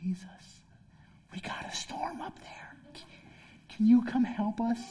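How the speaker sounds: hushed, tender whisper easing into a soft plea; loving, nurturing whisper. Acted. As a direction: gently wake someone with a whispered request. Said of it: "[name]'s gonna be the loving, nurturing one".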